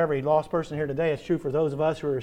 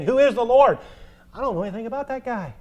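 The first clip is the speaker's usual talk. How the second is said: scornful Pharaoh; 5 semitones higher, 4 dB louder, faster than usual. Acted. Preacher voices scornful Pharaoh.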